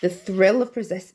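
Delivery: ordinary delivery, nothing marked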